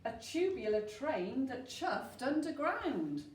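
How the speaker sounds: usual speaking voice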